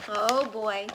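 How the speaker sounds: plain reading voice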